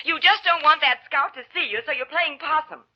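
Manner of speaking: slow burn. Acted. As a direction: let the simmering temper build to an ultimatum. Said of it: "It was Missus Casey doing a slow burn".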